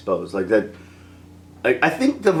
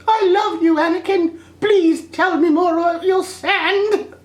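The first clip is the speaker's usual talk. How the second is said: much higher, slow, breathless adoring voice; swooning Padmé; slower than usual. Retold or imitated. Vlogger mocks Padmé swooning over Anakin's sand talk. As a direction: gush like a lovestruck girl.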